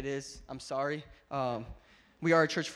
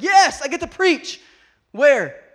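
mock excited yes; 10 semitones higher, 16 dB louder, slower than usual. Excited young preacher retold.

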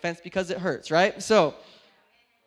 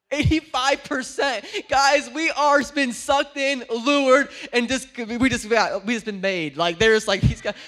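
louder, emphatic preaching; forceful preaching; 4 semitones higher, 13 dB louder than usual. Acted. Preacher telling congregation about movie concessions, forceful preaching. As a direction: press the point on the crowd.

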